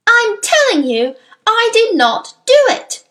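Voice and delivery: high, loud insistence; loud, very high denial; screamed protest. A narrator's reading. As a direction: scream your innocence at the headmistress. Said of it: "Matilda screamed".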